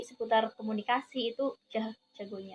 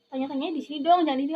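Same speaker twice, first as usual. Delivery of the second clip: ordinary talk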